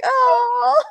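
loud, high, half-laughing sob; fake-cry at the goodbye; mock tearful oh. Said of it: "I was like".

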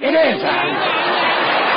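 drawn-out punchline. Acted.

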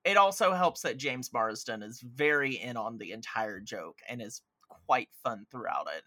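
usual speaking voice

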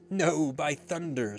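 loud, high, slow refusal; emphatic, drawn-out pirate oath; defiant cry. A narrator's reading. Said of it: "Starkey cried".

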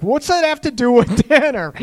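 high, loud, laughing through the question; laughing disbelief. Real, not acted. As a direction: laugh as you ask it. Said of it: "I'm laughing".

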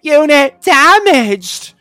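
high, loud, slow computer announcement. Retold or imitated. Host imitates the electrocuted heroine reporting damage, computer voice. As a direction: mimic a computer, drawn out and high. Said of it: "she talks in the computer's voice".

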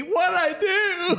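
high, drawn-out laughing wail; comic whine of a wronged husband; mock bewildered protest. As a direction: play the innocent victim for laughs. Husband protesting while beaten.

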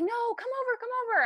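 high, wheedling mock plea; high mock whine of a drunk man; whiny drunk guy. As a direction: imitate a drunk guy begging her to come over. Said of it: "And he's like".

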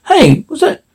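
high-pitched, mildly snappish questions; snapped. A narrator's reading. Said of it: "snapped Vifford".

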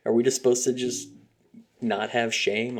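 ordinary talk, nothing marked

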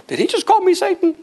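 high-pitched, incredulous mock churchgoer; offended churchgoer.

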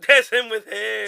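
loud slow repeat. Real, not acted. Repeats, louder and slower.

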